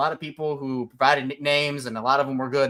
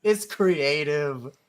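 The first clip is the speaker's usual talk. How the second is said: slow insistence; much slower than usual. Real, not acted. Defending his nickname.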